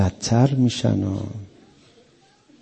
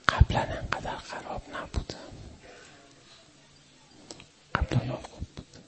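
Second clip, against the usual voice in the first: breathy hushed aside; whisper; 3 semitones higher, 14 dB quieter than usual. Real, not acted. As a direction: drop to a whisper, as if confiding. (نجوای آهسته)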